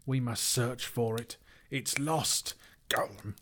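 high, desperate creature voice; wailing Gollum. A narrator's reading. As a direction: whine it out, ending in a throaty gulp. Gollum voiced, wailing over loss.

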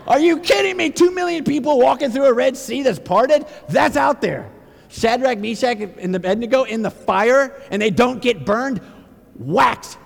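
high, loud incredulous preacher; fired-up exclamations to the congregation; impassioned preaching. Real, not acted. Preaches at full force.

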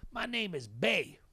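put-on slow, high old man's voice; imitated older Southern stranger; Bay. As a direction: introduce yourself with drawn-out words. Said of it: "He goes".

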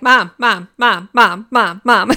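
loud, insistent repeated calls for mother; mock childish nagging, evenly repeated and loud; imitating nagging kids. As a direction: mimic a pestering child, hammering each word. Imitating kids pestering their mother.

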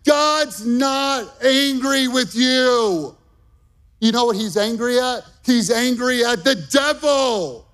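preacher declaring God's not mad at you, slow and rising; full-force preaching. Acted. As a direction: drive each line home to the congregation. Preaching climax.

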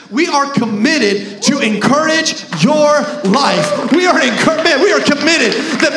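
loud rising sermon shouts; preacher building to a shout; full-force preaching. Acted. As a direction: drive it home to the congregation. Preaching climax to congregation.

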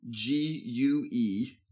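slow letter-by-letter spelling. Shown as a demonstration. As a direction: spell each letter out clearly, slowly. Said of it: "spell that for me there".

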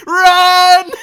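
high, full-voiced yell; hammed-up alarm, very loud and long; panicked shout. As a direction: scream it as if a lion jumped out. Acting panicked thought at lion.

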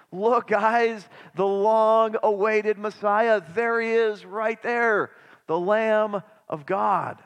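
loud, high and eager, slowing on the Messiah line; loud, enthusiastic. Retold or imitated. Preacher voicing John the Baptist pointing out Jesus to his disciples, loud and enthusiastic. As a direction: call out with excited announcement. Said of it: "says in a loud, enthusiastic voice".

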